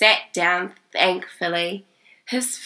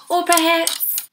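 salesman; 11 semitones higher, 3 dB louder, slower than usual. A narrator's reading. Salesman voiced in story.

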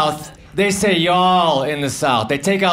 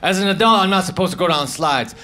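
usual speaking voice

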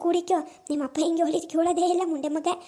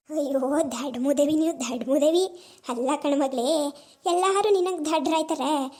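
put-on a grumbling old mother chiding her foolish daughter; 3 semitones lower than usual. Sick old mother chiding her daughter for wasting money, in a low old voice.

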